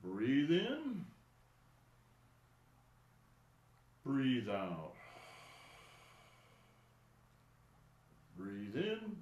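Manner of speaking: calm, spaced-out breathing instructions with long pauses; guided breathing cues. Acted. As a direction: guide the class through each breath, unhurried. Leads breathing exercise.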